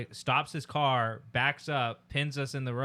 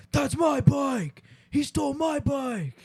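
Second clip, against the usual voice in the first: high, slow, shouted accusation; yelling man; 5 dB louder, slower than usual. Retold or imitated. Stranger across the street yells at them, high slow shout. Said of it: "just started yelling".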